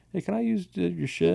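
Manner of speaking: sheepish voice pitched well up, asking a favor; a nervous fan asking grown men for their gear, pitched high and sheepish; timid high ask. Retold or imitated. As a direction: shrink into a small high voice and ask politely.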